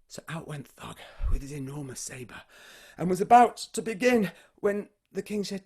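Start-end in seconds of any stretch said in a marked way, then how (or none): 0.1-2.4 soft, breathy whispered narration; hushed telling. A narrator's reading. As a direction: hush the room before the blow. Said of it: "whispered narration".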